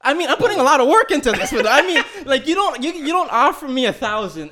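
high, loud complaint; worked-up, high-pitched objection; indignant protest. Real, not acted. Indignant complaint amid the group's laughter.

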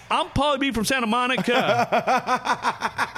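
high, slow, stretched-out announcement; hammed-up stage introduction. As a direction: bellow your name slowly to a festival crowd. Friend introduces himself on festival stage.

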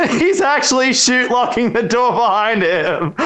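high, laughter-filled words; laughing while talking. Real, not acted. Storyteller cracking up mid-story, laughing as he speaks. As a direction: talk through a real laugh.

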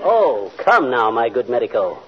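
slow, lofty stage-English reproach; mock English gent. Reproaching the doctor as a stuffy English lord. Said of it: "Who does he think he is today".

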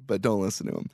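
sneaky low aside to the podcast listeners; sly aside. Pretend. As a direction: lean in and undercut him quietly.

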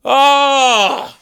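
high hammy cry of woe; anguished wail. Acted. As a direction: let out a wounded wail. Old Dick Lips outburst.

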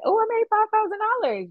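very high, quick little squeal of pride; retold excitement. Founder recalls first five thousand dollars, retold excitement. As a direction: race through it in a high-pitched thrilled voice. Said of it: "It was exciting to me".

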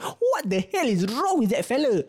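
slow, high-pitched disbelief; exasperated outburst. Retold or imitated. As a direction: stretch it out in exasperation at a friend's nonsense. Retold exasperation at silly classmate.

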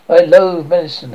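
loud, scornful burst; angry outburst. Acted. Lysander rejecting Hermia, loud scornful outburst. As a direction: spit the insult out loud.

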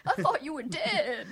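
laughing mock wrestler voice; hammed-up imitation, amused; terrible Mark Briscoe. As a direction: do a bad wrestler impression for laughs. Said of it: "That's my terrible Briscoe impersonation".

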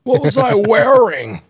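high, slow mock fan voice; whiny imitated listener; mocking fan question. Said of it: "He's like".

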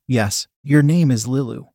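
usual speaking voice

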